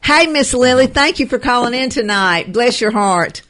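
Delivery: light up as the caller comes on; excited welcome. Real, not acted. Host greeting caller Miss Lily on the air, delighted and loud.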